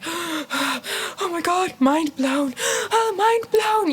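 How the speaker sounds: high, slow gasping awe; breathless high-pitched wonder; mind blown. Retold or imitated. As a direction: gasp it out like a revelation. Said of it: "the more I'm like".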